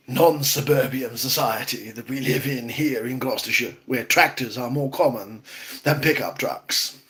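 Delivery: terrible put-on Attenborough voice; Attenborough impression. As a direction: narrate the garden like a wildlife film. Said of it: "I have a terrible Attenborough impersonation".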